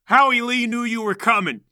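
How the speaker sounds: high, loud confrontation; loud accusation. Acted. Heatstroke confronts the old man, loudly accusing. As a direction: call out the trickster forcefully.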